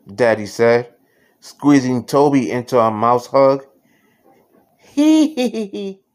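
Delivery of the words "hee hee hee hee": high squeaky "hee hee"; happy giggle. A narrator's reading. Happy mouse squeezed in a hug, high giggling.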